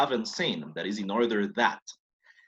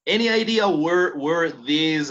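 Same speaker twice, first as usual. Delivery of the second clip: calls the question out to the class, louder and higher; raised voice; 4 semitones higher, 6 dB louder, slower than usual. Real, not acted. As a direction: pitch it up and out to the room.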